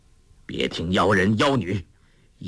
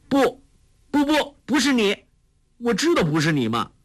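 put-on much higher, urgent girlish protest; anxious daughter; 14 semitones higher than usual. A narrator's reading. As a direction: blurt the denials in a rush. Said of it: "黄蓉急道". (少女急切地连声否认)

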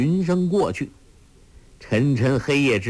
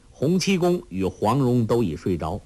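plain reading voice

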